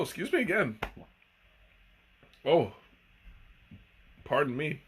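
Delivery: apologizing between hiccups. Real, not acted. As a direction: talk through the hiccups. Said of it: "the hiccups are, are starting to attack".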